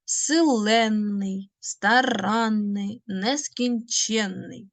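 slow, each syllable stretched out; slow careful stress. Shown as a demonstration. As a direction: spell out the stress in each word slowly. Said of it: "Не забувайте про наголоси". (повільно, чітко наголошуючи)

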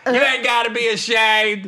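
high, called-out mock reassurance; hammed-up high holler; imitated hollering. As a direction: call it out to strangers in the next car. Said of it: "And Lunel goes".

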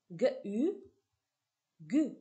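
hard "gue", then French "u", then blended into "Gu"; teacher blending "G-U" into "Gu"; sounding out syllables. Shown as a demonstration. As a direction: say the two sounds apart, then join them as "Gu". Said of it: "on va passer à la combinatoire".

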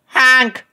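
loud high honk; big goose honk. A narrator's reading. The lost little goose honks loudly for his mother. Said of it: "he cried, with a big goose sound".

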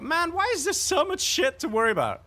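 very high exasperated whine; overwhelmed complaint. Real, not acted. Exasperated outburst.

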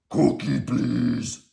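loud monster growl; rough, loud puppet monster; Cookie Monster. A narrator's reading. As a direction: growl out a greedy request. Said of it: "said Cookie Monster".

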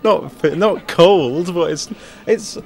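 laughing. Real, not acted. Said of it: "laughs while answering".

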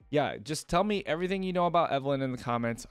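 ordinary talk, nothing marked

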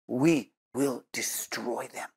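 quiet, slow and level, no anger; calm, steady resolve without raising the voice; said calmly. Retold or imitated. General vowing in an interview, calm and quiet. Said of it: "But he said it calmly".

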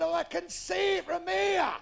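high, slow, loud yell; drawn-out long-distance shout; lookout's shout. Acted. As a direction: bellow the answer down from aloft.

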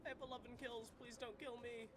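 high-pitched plea to be spared; high, worried begging; anxious pleading. Real, not acted. Pleading not to die in game.

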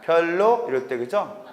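normal talking voice

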